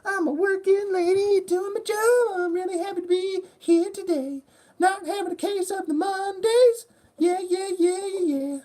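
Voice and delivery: very high, falsetto, cheery lady; hammed-up happy office worker; working woman announcing she is happy to be here; chipper working lady. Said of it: "She's, like, working".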